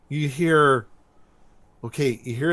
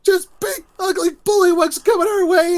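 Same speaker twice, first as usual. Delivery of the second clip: loud, very high falsetto shriek; hammed-up squealing shout of alarm; mock panicked yelling; 6 dB louder than usual. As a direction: imitate a hysterical coward raising the alarm. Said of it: "running around like he's gotta go pee and yelling".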